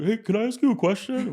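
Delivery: put-on high, timid young guy voice; lightly voiced nervous teen; imitating Jay. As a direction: pitch up like a nervous kid asking. Jay voiced in retold story.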